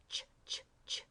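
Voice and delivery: "ch" as short voiceless bursts; "ch, ch, ch" separately, no vowel after; CH digraph sound. Shown as a demonstration. Teacher showing what C H says, "ch" in separate voiceless bursts. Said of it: "C H says".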